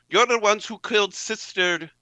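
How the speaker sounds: high, loud furious accusation; accusing shout. Acted. Sister B confronts the men who killed her sister, shouting. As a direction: hurl the blame at them as you shoot. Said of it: "and she says".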